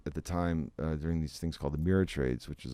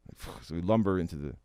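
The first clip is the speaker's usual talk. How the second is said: ordinary talk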